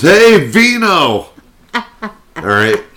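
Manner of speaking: high, drawn-out hey Vino; hammed-up shouted hey Vino; loud mock greeting. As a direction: call out Vino like the loud coworker. Imitating guy greeting loudly.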